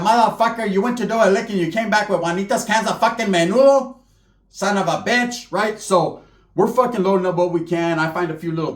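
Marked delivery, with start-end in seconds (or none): 0.0-5.5 mock scolding tia. Tia scolding her son for bad loot, angrily. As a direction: rant like an aunt who found the menudo cans. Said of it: "I can imagine my tia".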